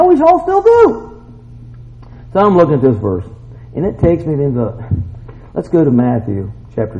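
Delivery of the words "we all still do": high, loud, drawn-out cry; rallying shout. Real, not acted. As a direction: shout it out for the whole congregation. Rallying the congregation's answer.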